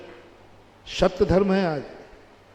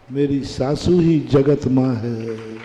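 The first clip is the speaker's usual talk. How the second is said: slow, reverent declaration; slow, reverent awe of her mother-in-law; awed reverence; 5 semitones lower, 4 dB louder, slower than usual. Acted. Awed devotion by daughter-in-law.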